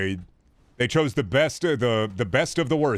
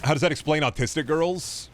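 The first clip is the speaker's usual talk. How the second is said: ordinary talk, nothing marked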